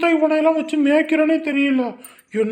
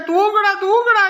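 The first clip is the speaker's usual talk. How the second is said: higher-pitched, pleading order to sleep; exasperated hushing; 5 semitones higher, 3 dB louder than usual. Acted. Frazzled parent to a crying baby, exasperated hushing. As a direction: urge the baby to sleep, voice climbing.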